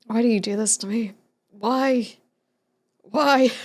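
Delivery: playfully wounded plea, building to a cry; mock anguish. Streamer to chat over a pizza joke, mock anguish. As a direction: plead as if betrayed, hammed up.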